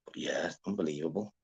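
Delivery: soft whisper, barely voiced; whispered aside. Real, not acted. Streamer murmurs to the chat in a whisper. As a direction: breathe it out to yourself.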